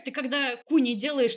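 ordinary delivery

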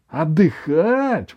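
high, stretched-out outraged echo; indignant. A narrator's reading. Grandmother told by grandson to rest, indignant. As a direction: repeat «Отдыхать» slowly in rising outrage. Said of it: "возмутилась бабушка".

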